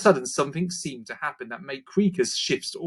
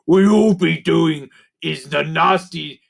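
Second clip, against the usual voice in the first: slow, loud creature voice fighting a laugh; giggly creaker; 4 semitones higher, 5 dB louder, slower than usual. A narrator's reading. Gruff agreeing with the others, in a slow loud creaker voice. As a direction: agree like a goblin about to crack up. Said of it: "agreed Gruff".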